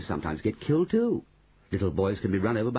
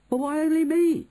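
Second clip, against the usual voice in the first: put-on loud, high-pitched boy's complaint; aggrieved high outburst; indignant protest; 6 dB louder than usual. A narrator's reading. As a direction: protest at being singled out. Bruno protests being singled out.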